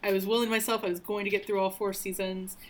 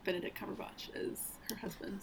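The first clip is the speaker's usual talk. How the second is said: whisper; 11 dB quieter than usual. Real, not acted. Muttered aside, measures unvoiced.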